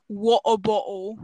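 put-on slow, glottal-stopped British meme accent; exaggerated British accent; mock British accent. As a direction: drop the t's like a British meme. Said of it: "British people be like".

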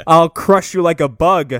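loud, slow monster threat; hammed-up menace, every word drawn out; Goro. Goro threatening little Kano, loud mock menace. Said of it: "And Goro's like".

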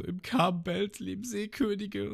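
high, slow, scared Nami voice; Nami imitated. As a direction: say it with mock dread. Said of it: "Und Nami so". (hohe, langsame, ängstliche Stimme)

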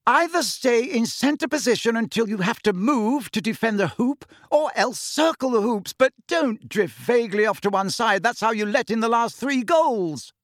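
high, insistent telling-off; captain's scolding. A narrator's reading. Captain to keeper who let in goals, high scolding. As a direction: drill the instructions into a failing teammate. Angelina lecturing Ron on the pitch.

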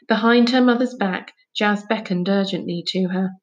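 ordinary delivery

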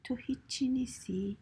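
plain reading voice

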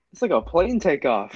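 loud slow amazement; excited. Real, not acted. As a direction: wow it out. Amazed at acceleration.

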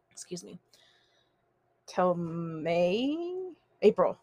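throat catching, clearing through it; choking on spit. Real, not acted. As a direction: talk past a caught throat. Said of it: "I'm choking on my spit".

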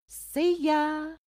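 high, drawn-out mock farewell; sing-song sign-off. Host dismissing Byron after defending Frankenstein, mock sing-song farewell. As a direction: toss out a high, held goodbye.

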